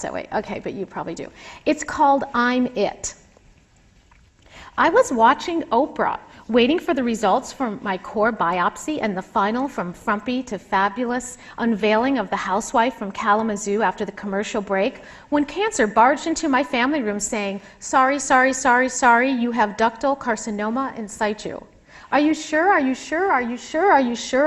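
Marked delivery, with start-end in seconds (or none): 1.7-21.6 expressive reading of her own memoir; author reading aloud. Acted. Author reads her chapter aloud. 22.1-24.5 repeated, pleading, higher and louder; blabbering. A narrator's reading. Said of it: "I blabbered".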